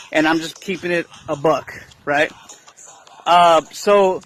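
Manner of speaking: ordinary talk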